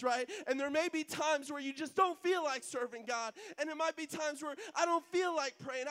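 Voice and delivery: loud, high exhortation; preaching at full force. Acted. Preacher urging the congregation to keep serving, loud and fervent. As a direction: drive it home, urging them on.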